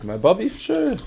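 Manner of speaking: mock casual reply; offhand self-quote. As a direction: toss it off breezily. Narrator quoting himself, put on.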